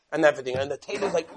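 ordinary delivery

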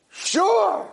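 very high, far-off "sure"; squeaky ugly woman. Acted. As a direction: chirp it high and odd. Hilda answers.

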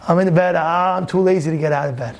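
low, listless drone from bed; too lazy to move, voice sagging low; sluggish, lazy monotone; lazy excuse. Acted. Acts lazy man in bed.

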